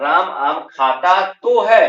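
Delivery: खाता तो है said slow, high and loud; stress on the verb. Shown as a demonstration. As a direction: lean hard on खाता तो है, slowly and loudly. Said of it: "जोर दे देता है।". (क्रिया पर ज़ोर, धीमे और ऊँचे स्वर में)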